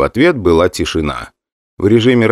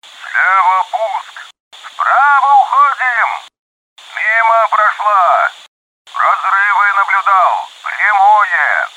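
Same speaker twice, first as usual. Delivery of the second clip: radio chatter; 13 semitones higher, much slower than usual. Acted. Combat calls on the radio.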